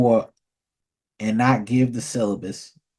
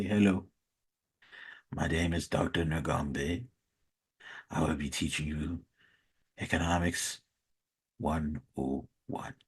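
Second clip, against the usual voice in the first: put-on low Nigerian accent; 8 semitones lower, 12 dB quieter than usual. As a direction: mumble it low like a quiet Nigerian lecturer. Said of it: "they talk in a very low voice".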